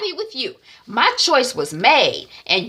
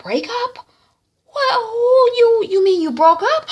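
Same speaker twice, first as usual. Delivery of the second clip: high, incredulous mother's questions; mock disbelief at the news; shocked mother; 13 semitones higher, 3 dB louder than usual.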